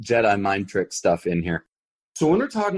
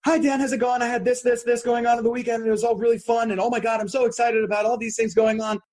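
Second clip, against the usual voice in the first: much higher, bubbly and excited; fast high visual talker; 13 semitones higher, faster than usual. Retold or imitated. A man imitating his friend Sophie calling him, high and excited. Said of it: "Sophie may call me up and go".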